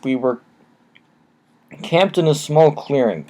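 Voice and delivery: ordinary delivery, nothing marked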